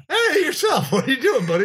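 mock cheerful. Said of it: "laughing, then quoted reply".

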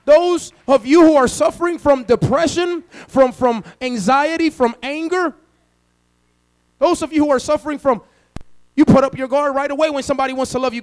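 usual speaking voice

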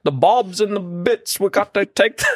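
high, exaggerated Cockney; mock Cockney accent. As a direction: fumble through a high Cockney impression. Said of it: "They say, like".

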